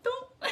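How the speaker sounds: high, soft "doop" sound effect; little toppling noise. Retold or imitated. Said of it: "she just went".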